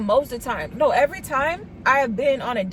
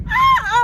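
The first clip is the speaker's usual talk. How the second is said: excited shriek; 8 dB louder than usual. Retold or imitated. Thrilled at his small appetite.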